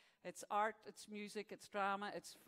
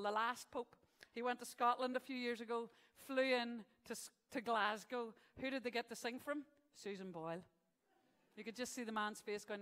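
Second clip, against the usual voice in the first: normal talking voice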